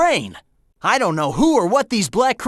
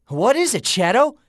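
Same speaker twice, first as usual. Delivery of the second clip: normal talking voice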